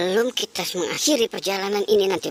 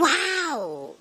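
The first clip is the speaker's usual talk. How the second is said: higher, long swooping wow; amazed wow; 7 semitones higher than usual. Pretend. Finding another ondel-ondel toy, amazed. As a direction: stretch the wow in wonder.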